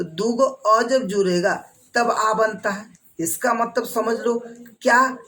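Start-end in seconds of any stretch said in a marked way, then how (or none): none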